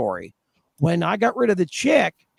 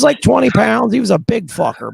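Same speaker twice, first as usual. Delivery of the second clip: ordinary delivery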